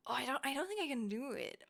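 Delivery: usual speaking voice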